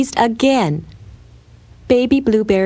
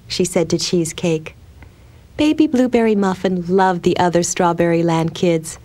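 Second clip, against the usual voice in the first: plain reading voice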